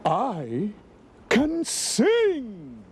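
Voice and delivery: proud announcement climbing high; grand exclamation. A narrator's reading. Eduardo offering his rescue plan, exclaiming grandly. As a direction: declaim it like an opera star. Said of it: "exclaimed Eduardo".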